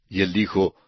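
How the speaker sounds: usual speaking voice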